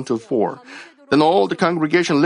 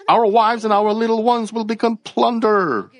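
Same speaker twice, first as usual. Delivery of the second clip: higher, whiny complaining tone; mock grumbling; 9 semitones higher than usual. Pastor imitating grumbling Israelites, higher whiny complaint. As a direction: whine it like a grumbling crowd. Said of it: "They grumbled against God".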